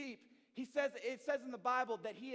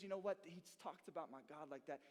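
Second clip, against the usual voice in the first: quiet conviction; 9 semitones lower, 11 dB quieter than usual. Retold or imitated. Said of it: "he says".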